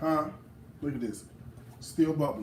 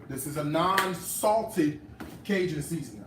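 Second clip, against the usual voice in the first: normal talking voice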